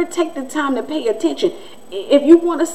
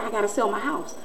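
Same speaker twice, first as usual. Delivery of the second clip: much softer retold confession; resigned neighbor; 10 dB quieter than usual. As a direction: drop the volume, imitating a man confiding. Retells neighbor's resigned remark.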